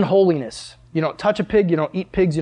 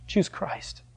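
soft whisper, much softer; preacher urging listeners to choose Christ, whispered; whispered plea; 2 semitones higher, 10 dB quieter than usual. Real, not acted. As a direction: whisper it close to them. Urgent appeal to listeners.

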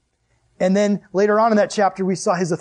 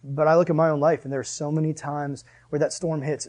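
ordinary delivery

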